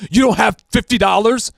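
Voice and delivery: retold scammer scoffing at the small amount; mock mad disbelief. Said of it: "he gets mad at me and says".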